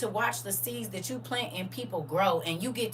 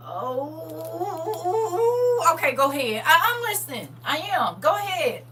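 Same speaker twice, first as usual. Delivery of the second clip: reluctant go ahead; 7 semitones higher, slower than usual. Retold or imitated. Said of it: "I'll be like this".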